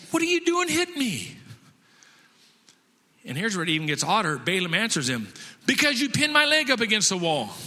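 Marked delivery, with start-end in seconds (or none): 0.1-1.4 high-pitched, loud donkey protest; indignant talking donkey. Acted. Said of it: "gives the donkey the ability to talk". 5.7-7.5 loud, high-pitched comic outrage; acted angry retort. Balaam argues back at his donkey.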